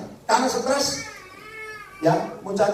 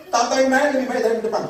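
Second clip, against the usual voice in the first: much higher and louder, rushing; preacher hitting the story's climax, much higher and louder; excited storytelling; 9 semitones higher, 5 dB louder, faster than usual. Real, not acted. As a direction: build the suspense, voice leaping up.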